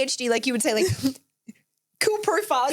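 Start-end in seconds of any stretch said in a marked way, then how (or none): 2.0-2.7 bot-like message. Retold or imitated. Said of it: "it sounded like a bot".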